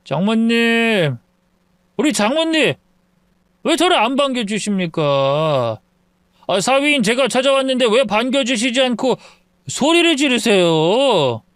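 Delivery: put-on a drunk man hollering at his in-laws' door; drunk son-in-law. (술 취한 사위의 높은 외침)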